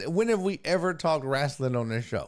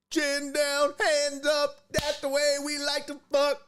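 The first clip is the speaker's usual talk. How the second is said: much higher, mock fighter chant; Joe Pro mid-fight; 11 semitones higher than usual. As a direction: ham up the fighter coaching himself mid-fight. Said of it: "I can see Joe Pro mid-fight".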